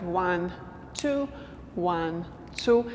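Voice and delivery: evenly spaced slow counting with gaps between numbers. Shown as a demonstration. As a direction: count slowly, one word per beat. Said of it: "if we do it slow".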